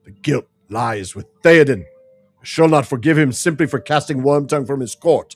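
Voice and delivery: angry accusation. Acted. Heated quarrel about the king.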